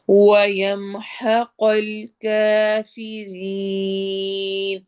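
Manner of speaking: slow melodic tajweed chant; measured Quranic recitation. Shown as a demonstration. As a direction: recite slowly, stretching the long vowels. Recites verse phrase.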